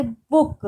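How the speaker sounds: बुक with the closing क hit hard; बुक, weight on the last consonant; stress on final क. Shown as a demonstration. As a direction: punch the k sound that ends बुक. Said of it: "उस पर बहुत जोर देते हैं".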